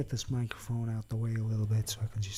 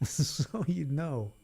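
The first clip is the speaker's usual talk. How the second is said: laughing; much slower than usual. Acted. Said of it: "laughing repeat".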